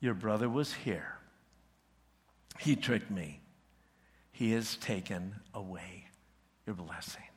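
low, soft, heavy telling of bad news; hushed, sorrowful old father; quiet sorrow. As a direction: break it to your son gently, voice sinking.

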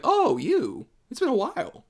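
high, amused recognition; mock surprise. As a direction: greet an old face you forgot. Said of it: "I'm like".

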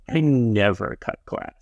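ordinary talk, nothing marked

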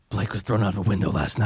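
low, grim noir delivery; Rorschach voice. Pretend. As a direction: growl it like a masked vigilante. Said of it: "Who sounds like that in Watchmen?".

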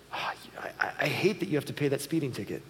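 whispered, sorry for them; sympathetic whisper. Shown as a demonstration. Dad to teen over speeding ticket, whispered sympathy. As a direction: hush it, full of sympathy. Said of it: "it goes like this".

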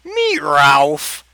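loud, drawn-out cat yowl; cat screech. A narrator's reading. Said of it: "Handicap screeched out".

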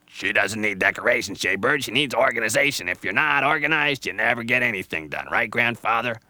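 loud, busy know-it-all squirrel; a fussy squirrel lecturing; bossy squirrel voice. Acted. Squirrel lectures Jaybird on organization, loud and bossy.

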